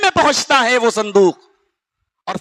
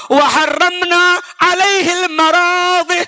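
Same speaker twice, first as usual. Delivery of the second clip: higher, chanted recitation voice; Quranic recitation; 7 semitones higher than usual. Acted. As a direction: lift into recitation of the verse. Quranic verse recited in sermon. (اونچی آواز میں قرآنی تلاوت)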